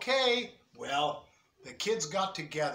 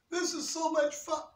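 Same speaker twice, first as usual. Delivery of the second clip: much higher, delighted; excited kid; 10 semitones higher than usual. Acted. Kid on the tall slide, excited higher voice. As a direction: squeal it like a happy child.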